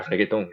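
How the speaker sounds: reggaeton in Spanish, hard g, "-tón" ending; Puerto Rican pronunciation. Shown as a demonstration. Showing how he grew up talking, Puerto Rican reggaeton. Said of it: "I could say it like it's Puerto Ricans".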